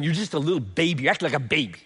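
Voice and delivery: lightly voiced scornful talk to a baby; a hint of belittling a child; mocking belittling tone. Shown as a demonstration. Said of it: "That's totally not the tone of this moment".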